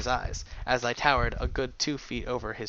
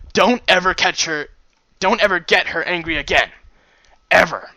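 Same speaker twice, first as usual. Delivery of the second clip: loud, higher, punched words; emphatic warning; 5 semitones higher, 10 dB louder than usual. A narrator's reading. Narrator warns himself about her temper, loud and emphatic. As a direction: drive the warning home at full voice.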